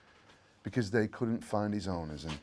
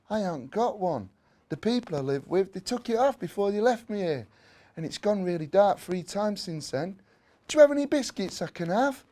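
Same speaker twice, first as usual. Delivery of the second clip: high, small pleading puppy voice; a little stray dog asking for biscuits, high and timid; small lost puppy; 7 semitones higher than usual. A narrator's reading. Abandoned puppy asks Dobie for biscuits, high and small.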